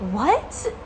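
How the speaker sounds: sudden high, baffled what; stunned what. Retold or imitated. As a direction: react in total disbelief. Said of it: "And I'm just like".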